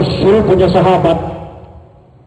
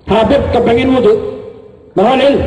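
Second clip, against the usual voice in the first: calling out; 7 semitones higher, faster than usual. Acted. Guest calls out to the kiai.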